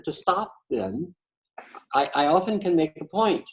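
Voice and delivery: normal talking voice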